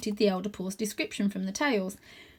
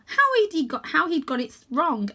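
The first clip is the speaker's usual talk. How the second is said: ordinary delivery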